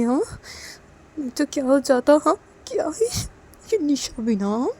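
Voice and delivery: high, loud aggrieved grumbling; indignant self-talk. Acted. Manisha alone with baby, misunderstood by mummy, indignantly grumbling. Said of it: "अपने में से बोलने लगती है".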